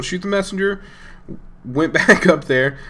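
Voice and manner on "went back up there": usual speaking voice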